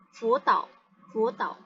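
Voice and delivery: brief "fu tao" with no final upturn; changed, half tone. Shown as a demonstration. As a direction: say "fu tao" compactly, ending on the drop. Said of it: "Udah, kayak gitu aja".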